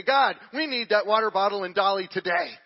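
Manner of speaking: loud, urgent petition; acted bold prayer. Preacher showing bold prayer to God, loud and urgent. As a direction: push it out boldly, demanding an answer.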